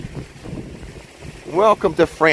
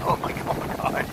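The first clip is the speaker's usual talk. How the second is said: awed whisper; 3 semitones higher, 9 dB quieter than usual. Real, not acted. Gasps at the wind.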